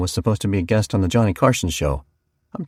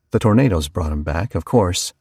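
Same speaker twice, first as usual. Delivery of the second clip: usual speaking voice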